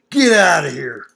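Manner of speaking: loud, sharp order to leave; angry shout. Retold or imitated. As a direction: throw him out with one loud command. Bar owner throwing father out.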